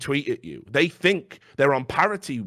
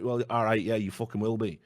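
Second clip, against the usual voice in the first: ordinary talk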